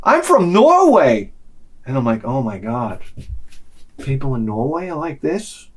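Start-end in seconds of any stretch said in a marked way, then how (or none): 0.0-1.3 high, slow, cheery singsong; imitated girl, proudly happy; happy Norwegian girl. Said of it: "big smile, like, happy". 2.2-5.7 low, hushed amazement; soft, lowered voice of acted disbelief; stunned aside. Stunned aside to himself in story.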